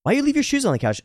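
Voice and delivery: high-pitched imitation of an accusing housemate; confrontational demand. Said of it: "And it didn't go well".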